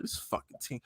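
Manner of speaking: whispered disgust; muttered under the breath in disbelief; resigned whisper. Retold or imitated. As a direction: breathe it out, fed up and defeated. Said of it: "I'm like".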